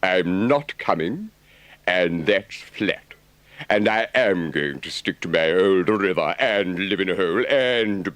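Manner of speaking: firm, stubborn Rat; digging his heels in; Rat's voice. A narrator's reading. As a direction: Rat refusing Toad's trip, lightly voiced and firm. Rat refusing firmly.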